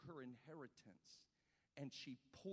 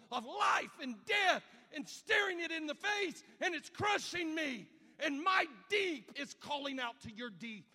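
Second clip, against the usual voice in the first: loud, high, impassioned preaching shout; preacher at full cry, voice raised and straining; full-force preaching; 13 semitones higher, 13 dB louder than usual. Acted. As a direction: climb to a roaring pulpit peak.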